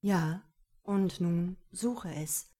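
drawn-out playful prompt; a touch of a man's coaxing voice; slow teasing Kerim. A narrator's reading. Kerim urges her to search.

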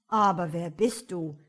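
loud, gruff fool; lazy fool voice. A narrator's reading. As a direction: blurt it out like a lazy peasant. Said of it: "fragte der Narr".

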